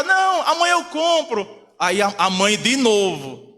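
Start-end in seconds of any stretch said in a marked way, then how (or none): 0.0-1.7 high, shouted mock protest; raised-voice imitation of a dad refusing; father's loud refusal. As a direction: act out a father loudly putting it off. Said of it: "Aí o pai fala".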